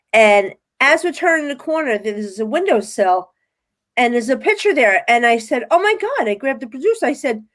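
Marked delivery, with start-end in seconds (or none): 5.7-6.3 shocked to see the face from the vision; retold high-pitched oh my God; high surprised gasp. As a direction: pitch jumps up in amazed recognition. Spotting the picture on the windowsill.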